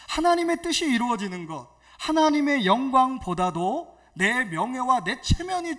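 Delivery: loud, emphatic sermon delivery; forceful preaching. Real, not acted. Pastor to congregation on God's glory, forceful preaching. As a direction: press each point on the congregation.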